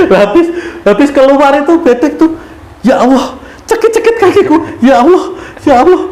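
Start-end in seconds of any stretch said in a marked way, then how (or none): 2.8-6.0 high, whiny retelling of aching feet; mock pained cry. Pilgrim complaining of stones hurting his feet, mock pained. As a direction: wail lightly about sore feet. Retells pain of walking barefoot.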